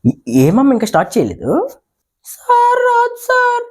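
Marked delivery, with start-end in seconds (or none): 2.3-3.6 put-on high girlish whine; high schoolgirl voice. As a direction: plead to sir like a little girl. Schoolgirl says she can't.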